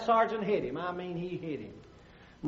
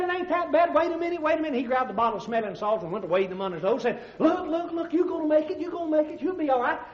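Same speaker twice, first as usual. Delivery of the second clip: frantic doctor; 6 semitones higher than usual. Acted. Doctor frantically reviving patient.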